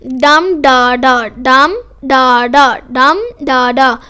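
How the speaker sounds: slow chant, stress on the first डम; dactyl stress. Shown as a demonstration. Teacher demonstrating the dactyl beat, stress on डम before unstressed डा डा. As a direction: punch डम, let both डा fall unstressed. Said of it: "पहला stressed है और बाकी दो अनस्ट्रेस्ड".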